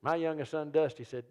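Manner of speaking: ordinary talk, nothing marked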